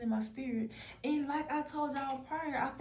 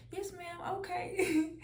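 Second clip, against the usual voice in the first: high, lightly mocked "Yes, ma'am, okay" with a laugh; imitating a dismissive agreeing girl; mocking yes-ma'am; 8 semitones higher, slower than usual. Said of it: "you the type that say".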